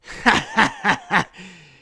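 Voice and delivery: scornful chief laughing at the challenger; mocking laugh. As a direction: laugh him off. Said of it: "looks over at you and laughs".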